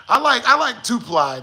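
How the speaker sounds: ordinary talk, nothing marked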